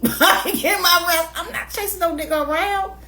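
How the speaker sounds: high amused brag; laughing boast. Real, not acted. Boasting to women viewers, high laughing.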